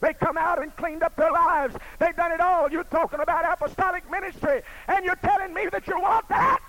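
from steady to loud, high preaching; building preaching. Acted. Preacher challenging the church, building to full preaching. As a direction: build it up to a shout.

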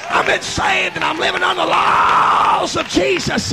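full-force preaching. Real, not acted. Preacher living under the laws of Jesus, jubilant shout. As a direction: build to a roaring, stretched-out declaration.

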